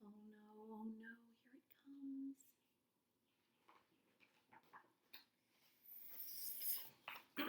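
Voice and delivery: hushed, bracing for the worst; dread whisper. Acted. Bracing before silly sounds.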